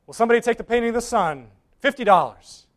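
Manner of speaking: quick, brisk auctioneer call. Acted. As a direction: rattle off the opening bid. Auctioneer asks for a bid.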